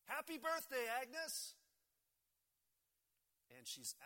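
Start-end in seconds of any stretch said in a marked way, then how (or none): 0.0-1.5 high, loud celebratory shout; surprise-party shout. A narrator's reading. Whole diner greeting Agnes at her party, shouting. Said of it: "and they all shout".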